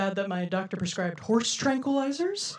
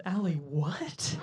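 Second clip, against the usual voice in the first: baffled deadpan; 3 semitones lower, 7 dB quieter, much slower than usual. Retold or imitated. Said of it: "she said".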